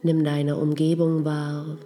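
ordinary talk, nothing marked

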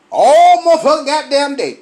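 loud, high cussing release; exasperated outburst. Acted. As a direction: drop the politeness and blow up. Drops work voice, vents frustration.

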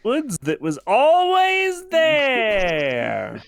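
hammed-up indignant correction; mock insistent shout.

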